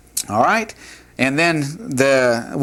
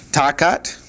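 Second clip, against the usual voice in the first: "takat" stressed on its last syllable; Hebrew word stress. Shown as a demonstration. Teacher marks for students where "takat" is stressed. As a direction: set the accent on "kat" in "takat". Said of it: "the accent right there".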